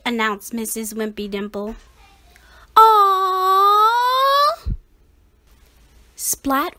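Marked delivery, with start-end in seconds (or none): 2.8-4.7 loud high aw; disappointed groan. A narrator's reading. The class let down, loud high aw. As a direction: groan in dismay.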